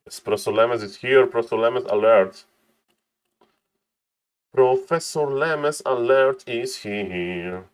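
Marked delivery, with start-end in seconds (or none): 4.5-7.6 drawn-out announcer call. Acted. Caster welcoming Professor Lemes, slow drawn-out hype call. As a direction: stretch every word out very slowly. Caster hypes player's arrival.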